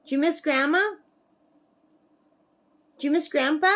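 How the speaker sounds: high, lilting treat-offer voice; coaxing a pet. Real, not acted. Coaxing her bird to answer.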